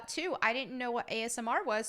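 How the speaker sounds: normal talking voice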